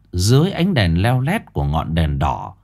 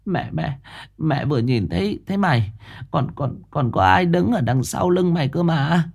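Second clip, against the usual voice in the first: softer, higher, stumbling fearful words; stammering; 6 semitones higher, 4 dB quieter than usual. Acted. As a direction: stutter it out, still scared. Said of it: "Bà lắp bắp".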